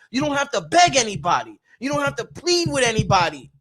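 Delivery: usual speaking voice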